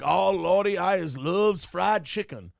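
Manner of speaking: slow, hammed-up Southern dialect. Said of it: "So I can go like".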